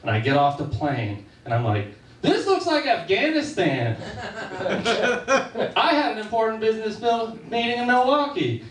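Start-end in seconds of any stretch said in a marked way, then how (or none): 2.2-8.6 a dismayed traveler who can't believe where he landed; alarmed protest. Retold or imitated. Stranded passenger protests in alarm.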